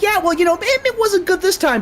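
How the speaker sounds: high, polite public-facing voice; mock public nice voice. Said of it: "but then behind closed doors".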